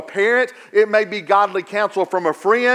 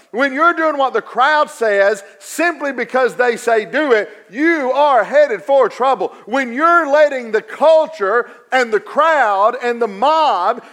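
preaching at full force; 3 semitones higher than usual. Acted. Preaching climax.

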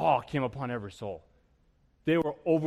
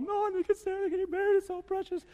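mock weepy squeal, very high-pitched; tearful gushing guests. Wedding guests cooing over the couple, high mock crying. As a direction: gush in a high, hammed-up sob. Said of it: "you're all crying, you're all going".

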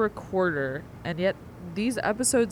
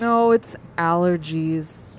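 slow, congested voice; stuffy nose; 4 semitones lower, 6 dB louder, slower than usual. Acted. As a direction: talk through blocked sinuses. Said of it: "Are you sick?".

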